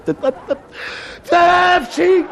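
high building comic sneeze. Acted. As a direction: wind up into a big fake sneeze.

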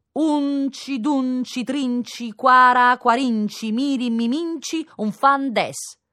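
a counting rhyme in a lightly put-on Modena lilt; Modena dialect counting. Child reciting a counting rhyme in a mild Modena manner. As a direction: chant it the way children count in Modena, slowly at the end. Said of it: "alla maniera di Modena".

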